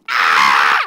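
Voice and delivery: sudden loud low scream of fright; terrified scream. Acted. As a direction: scream as if seeing a ghost. Disciple sees ghost, screams.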